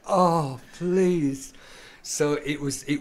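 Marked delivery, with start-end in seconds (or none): 0.1-1.5 exasperated plea. Retold or imitated. Retold exasperated reply to taunt.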